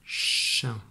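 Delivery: "Chien" whispered, falling; whispered dictation. Shown as a demonstration. Teacher repeating the syllable, whispered. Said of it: "pour écrire la syllabe".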